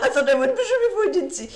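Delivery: fast, high, laughing through words. Real, not acted. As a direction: laugh at your own blunder. Laughing at own blunder.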